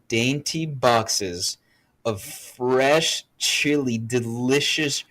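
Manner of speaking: slow, drawn-out savoring of each item; slow, relishing gift description. Retold or imitated. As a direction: linger lovingly over every treat. Lovingly listing the gift's contents.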